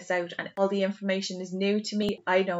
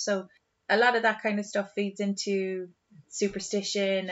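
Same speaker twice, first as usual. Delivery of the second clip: usual speaking voice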